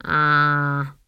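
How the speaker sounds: drawn-out gloomy granny "ah". A narrator's reading. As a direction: let out a heavy, doubtful "ah". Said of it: "said Granny gloomily".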